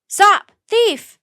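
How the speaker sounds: high loud yell. A narrator's reading. Butcher yelling as Jack runs past. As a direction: shout from the shop door. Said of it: "the baker yelled".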